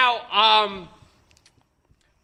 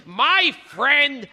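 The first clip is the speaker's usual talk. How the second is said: emphatic, punched show-host read; talk-show intro, each credit hit hard; announcer introduction; 5 semitones higher than usual. Acted. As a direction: announce the guest with big stressed names. Host reads the guest introduction.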